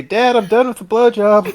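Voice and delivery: high, loud, innocently proud announcement; proud kid. Retold or imitated. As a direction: imitate a child proudly reporting to Dad. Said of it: "proud of myself because I finished".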